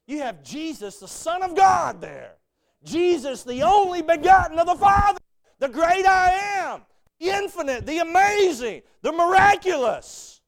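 thundering pulpit exaltation; preaching at full force. Acted.

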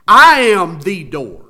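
slow, each word weighed; emphatic. Shown as a demonstration. Pastor quoting Jesus to congregation, slow and emphatic. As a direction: land it slowly and firmly. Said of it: "Man, you talk about emphatic".

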